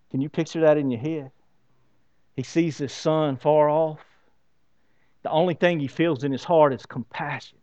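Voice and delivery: normal talking voice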